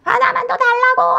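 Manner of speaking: high, loud, drawn-out demanding wail; child's tantrum. Acted. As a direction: imitate a child throwing a fit, stretched and high. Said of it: "그럼 이제 난리가 나는 거예요".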